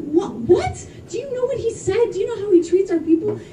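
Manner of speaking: "What? What?" jumping high in disbelief, then rising outrage; shocked disbelief. Retold or imitated. Comic telling the crowd her reaction to the Trump voters, shocked. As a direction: act stunned and indignant at once. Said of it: "Yes, I was shocked".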